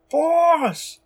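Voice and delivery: high, loud call through a wall; muffled shout. A narrator's reading. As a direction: yell for the boss from behind a door. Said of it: "shouts muffled voices".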